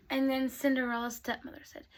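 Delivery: ordinary delivery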